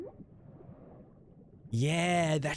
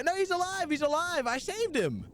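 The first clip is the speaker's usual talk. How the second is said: much higher, eager protest; excited insisting; 10 semitones higher than usual. Real, not acted. Streamer answering chat about the beluga, excited higher insistence. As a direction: insist it happily, pitch up.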